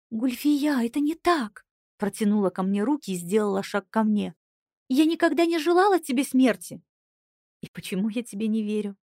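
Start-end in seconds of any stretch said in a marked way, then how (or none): 0.1-1.6 pleading, higher voice; desperate plea. Acted. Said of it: "отчаянно произнесла Кристиана". 4.9-6.8 higher, louder protest; pleading cry. Acted. Sister protests loudly. 7.6-9.0 smirking. A narrator's reading. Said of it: "усмехнулась я".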